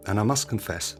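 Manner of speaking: ordinary talk, nothing marked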